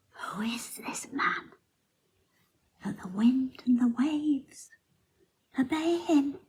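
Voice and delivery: hushed, high-pitched wonder; retold terrified awe. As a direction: ask it in awed, fearful half-whisper, imitating the disciples. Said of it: "they were terrified".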